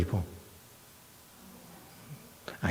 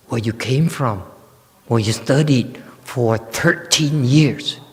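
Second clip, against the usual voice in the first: higher, louder, insistent encouragement; a teacher exhorting his student, louder and higher; emphatic urging; 7 semitones higher, 5 dB louder than usual. Acted. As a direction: press the point home with fervor. Master urging the young monk.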